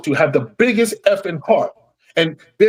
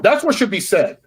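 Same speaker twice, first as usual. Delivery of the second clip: angry; 7 semitones higher than usual. Real, not acted. Angry rant continues.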